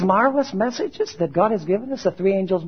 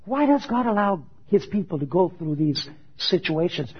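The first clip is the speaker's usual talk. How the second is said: normal talking voice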